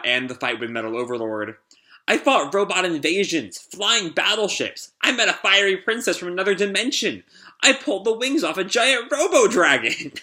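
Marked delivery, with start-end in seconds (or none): 2.1-10.1 each boast climbing higher in pitch; excited bragging. Acted. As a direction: build up the brag, peaking on the robo-dragon. Tangle flashes back excitedly.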